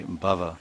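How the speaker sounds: bhava with a short clipped A. Shown as a demonstration. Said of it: "Short A".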